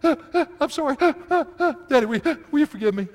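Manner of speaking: high, loud, fast mock blubbering apology; mock sobbing. Father imitating daughter's tearful apology, mock sobbing. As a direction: ham up a tearful kid begging. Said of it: "not really sobbing".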